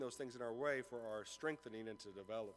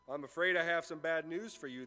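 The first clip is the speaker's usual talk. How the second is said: louder, smug and pleased; gloating; 3 semitones higher, 7 dB louder than usual. A narrator's reading. Professor gloating over the late student. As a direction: savour the bad news. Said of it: "the professor gloated".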